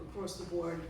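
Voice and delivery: ordinary talk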